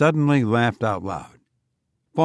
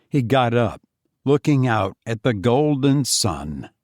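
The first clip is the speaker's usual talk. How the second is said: usual speaking voice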